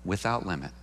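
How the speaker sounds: low, soft "Without limit", stress on "Without"; quiet weight on "Without limit"; soft answer. Shown as a demonstration. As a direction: say it gently but firmly. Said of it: "How many times should I forgive?".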